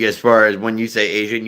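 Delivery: normal talking voice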